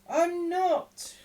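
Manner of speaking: high, loud frightened denial; greatly alarmed. A narrator's reading. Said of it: "replied Oliver, greatly alarmed".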